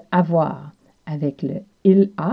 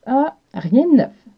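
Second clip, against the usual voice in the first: casual reply; 6 semitones higher, 3 dB louder than usual. Retold or imitated. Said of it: "si une personne vous demande".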